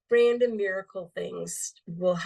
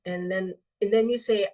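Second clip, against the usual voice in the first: ordinary talk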